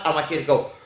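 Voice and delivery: ordinary delivery, nothing marked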